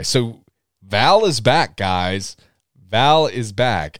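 drawn out slowly, leaning on Val; slow emphatic. Real, not acted. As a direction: announce it slowly, hitting Val. Hyping the character's return.

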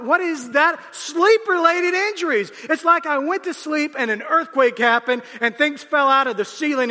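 loud, high comic preaching rant; preaching at full force. Acted. Pastor riffing to the congregation, loud and high. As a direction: belt it out like a pastor venting about aches.

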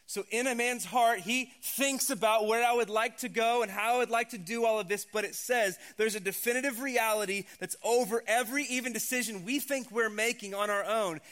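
preaching at full force. Acted. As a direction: preach it out to the whole room.

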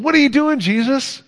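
high, scandalized questioning of Jesus; indignant Pharisee. Retold or imitated. Pharisee challenging Jesus about his company, indignant and high. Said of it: "he said".

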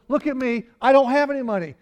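Peter's blunt reply, acted high and loud; mock Peter. Preacher acts Peter's blunt reply.

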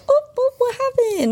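very high, lighthearted lilt; little sing-song. Retold or imitated. Trainer to corrected dog to keep things lighthearted, sing-song. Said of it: "having the little sing song".

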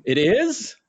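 high, loud surprised it is; learning he had the name wrong, high and stunned; stunned question. Retold or imitated. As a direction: squeak it out in disbelief. Acting own stunned reply.

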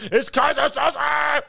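high, drawn-out panicked yell; loud acted-out holler; imitated shout. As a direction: scream the name like a terrified man. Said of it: "shouts it".

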